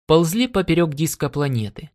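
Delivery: plain reading voice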